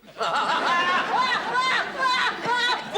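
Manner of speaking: high, long cackle; cackling laugh. Acted. Host laughs at his own limerick, cackling. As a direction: burst into high, raucous laughter at your own joke.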